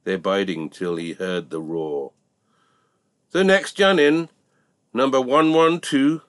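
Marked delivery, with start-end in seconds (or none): 3.3-6.2 loud auctioneer's call, pitched high. Acted. Auctioneer calling the next bull into the ring, loud.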